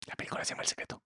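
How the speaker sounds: hushed reveal of a forbidden name; conspiratorial whisper; secretive whisper. Acted. Revealing the movie title as a secret.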